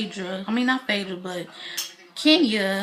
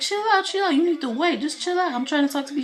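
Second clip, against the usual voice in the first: high-pitched mock pleading; imitated urgent pleading; 7 semitones higher, faster than usual. Man telling upset partner to wait, imitated high pleading. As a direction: act out him trying to settle her, pitched up. Todd urges crying Kandi to wait.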